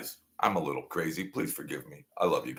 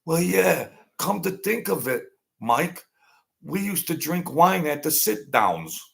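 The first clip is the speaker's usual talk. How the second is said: imitating partner Mike; 8 semitones higher than usual. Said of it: "and he says".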